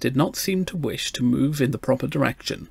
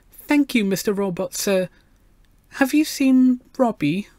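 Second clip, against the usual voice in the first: put-on high, polite little girl; treble child voice; 9 semitones higher than usual. A narrator's reading. Gloria asks the talking robot for help, polite treble. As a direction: ask it in a high, respectful child's voice. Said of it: "she raised her treble voice".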